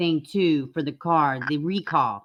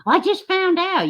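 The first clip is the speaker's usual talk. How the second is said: mocking quote; 6 semitones higher, 4 dB louder, slower than usual. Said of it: "You like".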